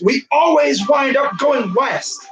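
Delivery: frustrated shout. Real, not acted. Frustrated shout about seeding.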